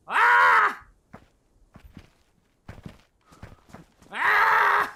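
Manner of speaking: high, loud panicked shriek; sharp real scream of fright; startled scream. Real, not acted. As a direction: scream as the bear lunges. Bear attacks in game.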